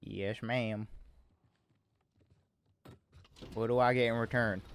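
lightly put-on Southern accent. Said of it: "I don't know why I'm talking in this accent".

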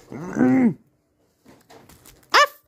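ordinary delivery

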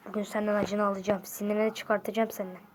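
low, soft threat; quiet menace. Acted. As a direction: threaten revenge under your breath. Threatens revenge.

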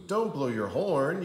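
usual speaking voice

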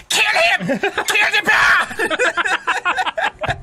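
loud, hammed-up attack order; shouted mock-Chinese command; mock battle cry. Dog urges killing the bear, hammed-up shout.

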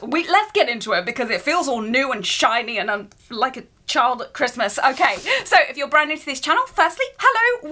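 normal talking voice

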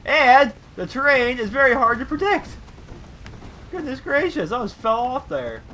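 high, loud, startled complaint; rattled exclaiming. Real, not acted. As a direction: exclaim it high after a near fall. Rattled after nearly falling off.

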